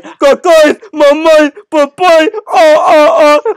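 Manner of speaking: high, loud crying shriek of nonsense; wild shrieking cry. Retold or imitated. Retelling a friend's cafeteria dare, shrieking high and loud. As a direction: wail it like a madman. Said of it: "like that".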